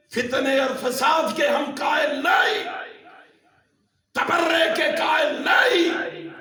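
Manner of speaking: loud sermon declaration climbing high; emphatic preacher's cry, pitch rising; full-force preaching. Real, not acted. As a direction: shout each denial out to the congregation.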